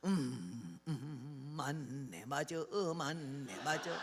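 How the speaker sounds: low, soft hums of assent; agreeing old man. Retold or imitated. Old man responding to the hymn, low appreciative murmur. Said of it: "이러니까 이래요".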